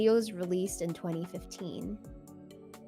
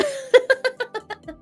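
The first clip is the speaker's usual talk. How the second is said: laugh; 4 dB louder than usual. Real, not acted. Laughing at own joke.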